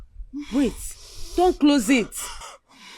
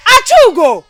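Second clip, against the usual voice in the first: much louder, higher crying shout; desperate yell; 13 semitones higher, 14 dB louder than usual. Acted. Mother to her daughter about the baby, a loud desperate cry. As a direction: call her name out in despair.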